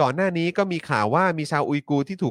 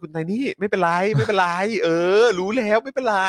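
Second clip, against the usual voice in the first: quick, higher, soothing a panicked caller; mock-calm over a phone call, pitched up; flustered reassurance; 6 semitones higher, 3 dB louder than usual. Host retelling a call from Tiny, flustered calm. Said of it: "ได้ยินเสียงคุณไทนี่โทรมา นี่ผมแบบ".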